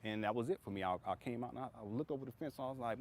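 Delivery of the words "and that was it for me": usual speaking voice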